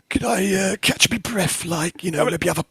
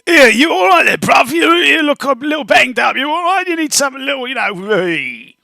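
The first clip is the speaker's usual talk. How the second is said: high, loud cheeky goblin voice; loud, high Cockney goblin checking on a friend; goblin character voice; 9 semitones higher, 4 dB louder than usual. Acted. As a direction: call out to a battered mate in a squeaky goblin voice. Goblin character greeting injured ally.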